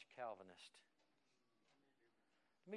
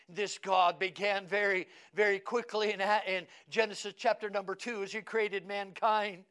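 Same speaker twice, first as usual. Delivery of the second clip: loud, high-pitched pulpit proclamation; full-force preaching; 7 semitones higher, 15 dB louder than usual. Acted.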